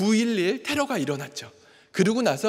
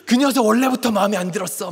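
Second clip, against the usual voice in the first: louder, imitated angry outburst; angry teenage daughter; 3 semitones higher, 6 dB louder than usual. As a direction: voice a furious teenager's complaint. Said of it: "어마어마하게 분노를 일으키며". (사춘기 딸의 화난 말투 흉내)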